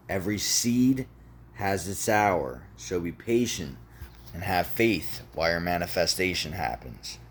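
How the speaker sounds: measured, with pauses between phrases; deliberate repetition. Shown as a demonstration. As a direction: let each phrase land before the next. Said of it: "One more time for the people in the back".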